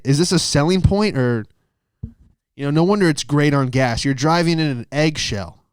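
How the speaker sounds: usual speaking voice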